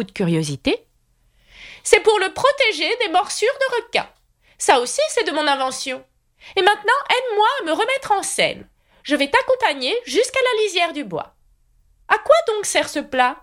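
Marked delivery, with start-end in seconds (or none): 1.8-13.4 put-on high, fussy old knight voice; high knightly voice promising to escort Alice. A narrator's reading. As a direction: play the kindly, dotty knight up high. Knight rambles.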